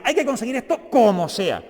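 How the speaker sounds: insistent quote with a punched "como sea"; emphatic political quote. Retold or imitated. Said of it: "cuando decía".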